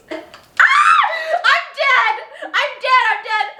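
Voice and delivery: high, loud shriek of laughter; cackling I'm dead through the cringe. Real, not acted. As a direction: scream like you're dying of embarrassment. Reacting to diary entry.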